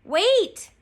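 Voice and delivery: loud, high-pitched yell; desperate storybook captain's shout; crying out. A narrator's reading. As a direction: cry it out after the departing boat. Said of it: "cried Captain Bones".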